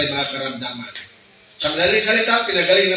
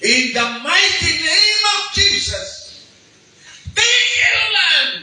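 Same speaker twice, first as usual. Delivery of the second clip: much higher, louder, slow rebuke of the rain; commanding shout; 14 semitones higher, 5 dB louder, slower than usual. Shown as a demonstration. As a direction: cry out the command to the sky, slowly. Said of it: "Sumigaw, ganito yung ginawa ko".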